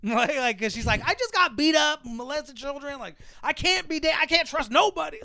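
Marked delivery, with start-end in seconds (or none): 1.1-5.2 high-pitched, exaggerated protest; mock outburst. She explains why she cannot date, high mock protest. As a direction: wail it as a hammed-up excuse.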